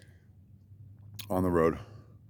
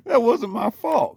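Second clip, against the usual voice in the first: much higher, louder, slow laughing; laughing excuse; 6 dB louder, slower than usual. Real, not acted. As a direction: protest through laughter.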